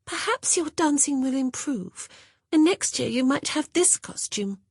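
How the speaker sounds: put-on high, smug little girl's voice. A narrator's reading. Young Monica taunting Honor about her costume, smug. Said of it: "so bloody smug about it".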